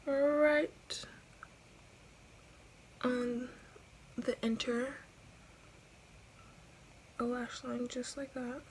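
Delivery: breathy whisper. Real, not acted. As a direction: whisper it close. Whispered application.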